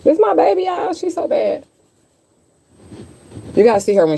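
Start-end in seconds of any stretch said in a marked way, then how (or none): 0.0-1.6 high doting pet voice. Real, not acted. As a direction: coo it up high to the cat. Cat returns, cooing at her.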